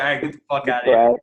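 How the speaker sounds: put-on a bouncer barking at a kid; retold bouncer yelling. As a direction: throw him out of the bar.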